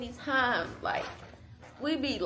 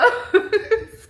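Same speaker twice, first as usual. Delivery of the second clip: laughing; 12 semitones higher, 9 dB louder than usual. Real, not acted. Laughing about the bag.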